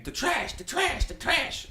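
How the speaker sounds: high-pitched, softened mock scream; imitated frantic screaming, held back. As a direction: squeal it urgently but keep the volume down. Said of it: "he's just screaming".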